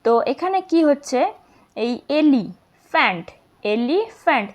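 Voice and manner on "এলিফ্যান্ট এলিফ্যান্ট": each "এলিফ্যান্ট" in two parts, "এলি" then "ফ্যান্ট"; split into syllables. Shown as a demonstration. Teacher explaining two syllables, "এলিফ্যান্ট" divided as "এলি", "ফ্যান্ট". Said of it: "এলি একটা syllable আর ফ্যান্ট একটা".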